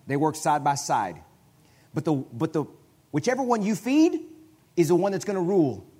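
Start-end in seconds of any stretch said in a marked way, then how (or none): 3.1-5.9 rising, louder preacher push; emphatic preaching. Real, not acted. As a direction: drive the point home. Preacher stressing climax.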